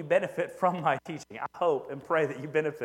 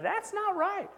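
inner objection; 14 semitones higher, slower than usual. Retold or imitated. Inner objection to bad theology.

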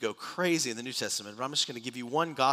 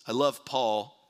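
ordinary talk

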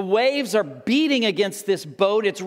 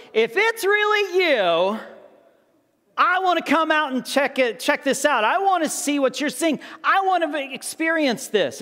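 higher-pitched, keen request; mildly eager; 6 semitones higher than usual. Acted. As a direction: lightly play an eager disciple wanting to try it. Peter eagerly asks Jesus to join.

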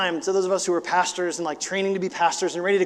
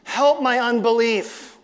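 pleading; 5 semitones higher, 5 dB louder, slower than usual. Retold or imitated. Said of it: "And he says".

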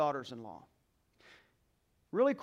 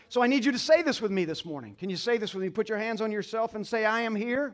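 rousing call to respond; 3 semitones higher, 6 dB louder than usual. Acted. Pastor calling congregation to respond.

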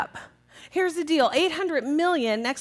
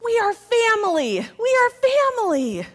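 imitating a five-year-old's yell; yelling like a child; 9 semitones higher, 7 dB louder than usual. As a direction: belt it out as a proud little kid. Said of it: "She yells".

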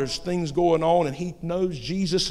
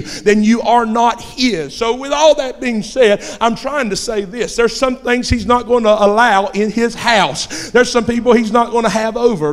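loud, raised-pitch preacher's delivery; full-force preaching; 5 semitones higher, 9 dB louder than usual. Acted. Preacher warning believers that Jesus goes wherever they go, full-force preaching. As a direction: drive each point home at full volume.

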